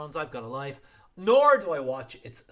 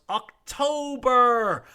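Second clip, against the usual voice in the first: long slow dramatic October, higher; drawn-out reveal; 7 semitones higher, 7 dB louder, much slower than usual. Real, not acted. Host revealing the month, drawn out and loud. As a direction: stretch it out like a big reveal.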